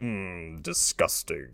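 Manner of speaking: low, soft monster voice; Goro. Acted. Goro reacting to Kano, low contemptuous monster voice. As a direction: drop deep and quiet with disdain. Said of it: "And then Goro's like".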